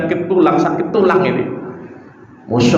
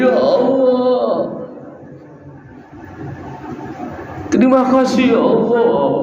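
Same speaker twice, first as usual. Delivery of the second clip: a mother's relieved, tearful praise; tearful thanks; 7 semitones higher, 3 dB quieter than usual. Retold or imitated. Mother crying out in thanks when the doctor appears. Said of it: "Itu nangis itu ibunya".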